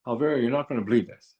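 usual speaking voice

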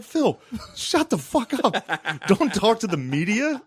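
high, drawn-out mock outrage; exasperated scolding. Son telling dad to avoid media, retold exasperation. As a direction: scold slowly in a high, strained voice.

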